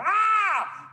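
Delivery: sudden very high, loud "ah" yell; comic scream before the blow lands; preemptive shout. Acted. Warding off a punch in the face, preemptive scream. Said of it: "I have a habit of preemptively shouting".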